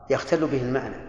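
normal talking voice

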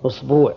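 أسبوع as "usbuu'", long u after ba; tenth variant. Shown as a demonstration. Teacher adding the tenth dialect form, أسبوع as "usbuu'". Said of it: "اللغة العاشرة".

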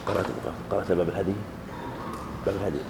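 very soft, low self-directed murmur; murmured aside. Real, not acted. Lecturer finding where the reading resumes, quiet murmur. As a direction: check your place quietly, half to yourself. Checking his place to himself.

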